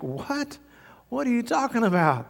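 very high, baffled question; retold incredulity. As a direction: act out an astonished receptionist. Receptionist reacts to being scolded.

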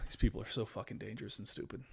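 whispered, fast exasperated aside after a sigh; exasperated under-breath. Real, not acted. Host whispering his disgust to the audience, fast.

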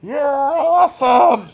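high, loud, slow fanboy cheer; cheering shout. Acted. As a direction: yell it like an adoring henchman. Henchman cheering the captain.

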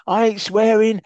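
high, loud put-on manager voice; Betis impression. Co-host doing Betis ranting about the club shop. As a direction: rant in character, ranting and fuming. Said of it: "That went a bit steptoe at the end".

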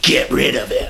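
lightly voiced villain dad giving an order; villainous father. Dad orders his daughter about Matt, lightly mocked villain father. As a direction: put on a touch of the dismissive dad. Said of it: "he says to Meredith, he's like".

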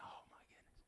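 barely audible horrified whisper; hushed dismay. Acted. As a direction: whisper it under your breath, as if thinking it. Parent's private dismayed thought.

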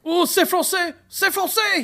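high-pitched mock French; French accent. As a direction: exclaim as a caricature Frenchman. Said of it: "just a French dude".

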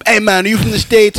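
high-pitched voice of an island stranger; imitated Bahamas local. Bahamas local approaching an American visitor, imitated high voice. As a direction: put on an eager, high-voiced local dude. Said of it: "this dude goes to me".

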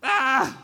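a high, loud wail of dismay; mock anguished father's shout; anguished cry. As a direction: cry out like a grieving father hearing bad news. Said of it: "And Jacob's like".

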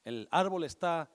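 ordinary delivery

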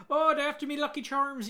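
loud, high cartoon Irish accent; Lucky Charms guy. Mocking an actress's bad accent as a loud, high cartoon Irish leprechaun. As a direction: ham up a cereal-ad leprechaun in Irish brogue. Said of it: "she might as well have just said".

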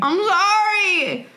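high, drawn-out sorry; mock apology. Mock apology to Australian listeners.

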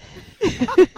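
a bright, embarrassed burst of laughter; high laugh. Real, not acted. Host laughing at her own chaotic morning. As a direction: laugh it off, a little sheepish.

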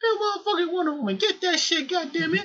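high, rushed fan outburst; hammed-up hype. Fan cheering Wonder Woman's Oscar, hammed-up hype. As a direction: rattle it off high, mock-insistent.

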